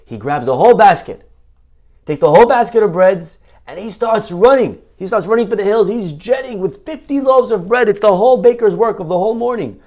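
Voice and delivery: story of the dog's heist told with building excitement; excited storytelling. Acted. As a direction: build the chase with rising excitement.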